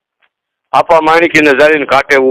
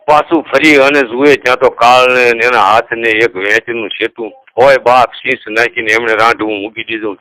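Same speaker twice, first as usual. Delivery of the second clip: plain reading voice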